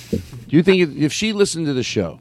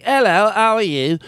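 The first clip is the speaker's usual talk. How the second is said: higher, drawn-out strong British accent; 6 semitones higher than usual. Shown as a demonstration. Host shows the strongest version of the dialect, strong British accent. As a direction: lay the British accent on thick. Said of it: "There's a very strong one that's like".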